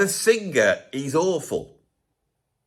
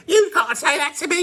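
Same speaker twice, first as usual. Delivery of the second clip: high thin whiny shout; weedy voice; 13 semitones higher, 3 dB louder than usual. Retold or imitated. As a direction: squeal it out like a weedy singer. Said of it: "with his weedy voice".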